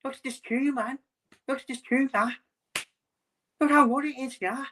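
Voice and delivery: high, whiny Scouse caricature; mock Liverpool accent. Scouse fan stuck in the chippy queue, high mock Liverpool accent. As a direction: complain in a put-on high Scouse voice. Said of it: "the Liverpool accent for me".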